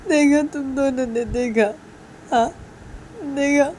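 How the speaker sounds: high, slow tearful wail; loud hammed-up sobbing; acted crying. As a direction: wail the complaint, drawing words out. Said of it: "फिर से रोने लग जाती है".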